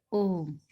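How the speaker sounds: "ओम" with a long-held vowel; drawn-out vowel. Shown as a demonstration. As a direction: stretch the vowel in "ओम", holding it before closing on the m. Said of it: "इसका उच्चारण लंबा हो रहा है".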